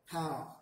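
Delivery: Pow with the vowel carried out long; long drawn vowel. Shown as a demonstration. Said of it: "You carry it out".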